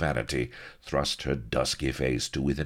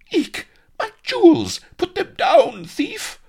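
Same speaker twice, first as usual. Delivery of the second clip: piercing, very high falsetto squeal; panicked shriek; 11 dB louder than usual. A narrator's reading. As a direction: squeal in loud outraged alarm.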